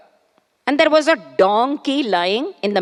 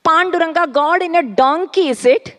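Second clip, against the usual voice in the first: higher, scoffing question; mocking laughter; 6 semitones higher, faster than usual. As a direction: scoff at the idea. Crowd mocks Ekanath.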